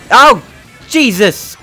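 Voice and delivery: sudden loud startled yelp; startled yell. Real, not acted. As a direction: jump and yell in shock. Startled by game.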